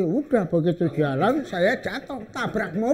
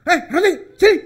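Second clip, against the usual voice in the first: calling out; 11 semitones higher, 7 dB louder, much faster than usual. Retold or imitated. Guard calls out to seller.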